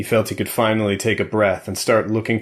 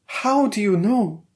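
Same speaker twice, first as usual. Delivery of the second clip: higher, slow, probing wife; Valeria's voice; 13 semitones higher, slower than usual. A narrator's reading. Wife doubts her husband's faith, slow and higher. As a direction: draw the question out, doubting him.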